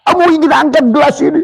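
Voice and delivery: high, fast shout of will; inner shout. Shown as a demonstration. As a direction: yell it out to make the hand move. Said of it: "kamu teriak".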